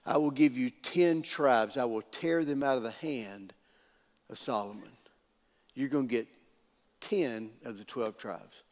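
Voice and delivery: low, hushed pronouncement; soft, measured, weighty decree; solemn prophecy. Acted. Prophet delivers the Lord's word.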